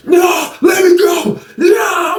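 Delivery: high shouted protest; mock struggling yell. Short guy yelling while held in the air, high mock shout.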